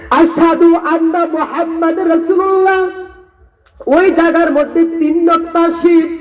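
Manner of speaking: high, impassioned sermon voice; fervent waz preacher pleading; preaching at full force. Acted. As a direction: plead as Abu Bakr from the pulpit.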